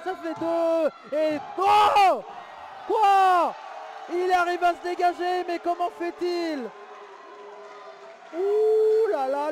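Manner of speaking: high, loud disbelieving yell; shocked outburst. Acted. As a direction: gasp at the kick-out. Shock kick-out in wrestling call.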